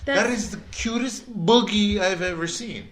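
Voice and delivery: high, gushing Canadian accent imitation. Quoting the Canadian girl about the stroller, Canadian accent. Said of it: "in my best Canadian accent".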